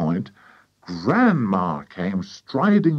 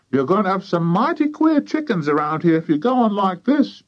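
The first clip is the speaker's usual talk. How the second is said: high, nagging warning; disapproving farmer's wife; 10 semitones higher, 4 dB louder than usual. A narrator's reading. Wife warning of queer chickens, high nag. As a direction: warn them in a high wifely voice.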